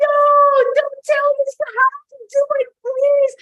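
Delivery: very high, loud, frantic outburst; freaking out. Retold or imitated. Woman protesting the founder's refusal, frantic and freaking out. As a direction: wail in exasperated protest. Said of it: "And I was freaking".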